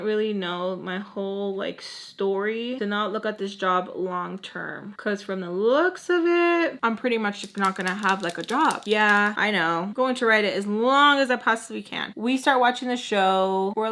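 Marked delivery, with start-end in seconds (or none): none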